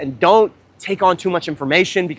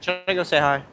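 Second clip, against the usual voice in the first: usual speaking voice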